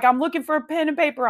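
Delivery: high, quick retold reply; a touch flustered, pitched well up; mildly frantic. Wife searching her purse for husband, mildly frantic.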